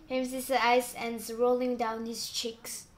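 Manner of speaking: ordinary delivery, nothing marked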